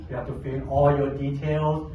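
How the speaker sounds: normal talking voice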